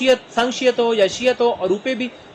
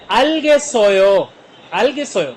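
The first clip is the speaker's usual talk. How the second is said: slow, louder drawn-out Korean; slow model phrase; 2 semitones higher, 5 dB louder, slower than usual. Shown as a demonstration. Teacher repeats the Korean question slowly for students. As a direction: spell it out slowly for learners.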